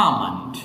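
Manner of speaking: আমন্ড with silent L, low falling voice; long "aa" then soft "mund" in আমন্ড; correct pronunciation. Shown as a demonstration. Teacher shows learners how almond is really said, correct pronunciation of আমন্ড. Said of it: "এটা উচ্চারণ হচ্ছে".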